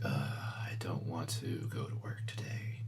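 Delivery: breathy whispered morning groan; a mock-weary whisper about going to work. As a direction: whisper it like you're dreading the alarm. Groggy morning complaint to self.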